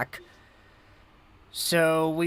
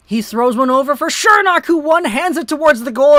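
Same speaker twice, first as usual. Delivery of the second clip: loud, higher hockey call; excited play-by-play; 7 semitones higher, 8 dB louder than usual. Acted.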